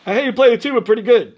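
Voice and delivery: loud, quick, high Brooklyn voice; Emil Shalop. Retold or imitated. Brooklyn contractor sizing up a tuba player, loud and brisk. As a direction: punch it out like a Brooklyn guy. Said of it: "a funny Brooklyn sounding voice".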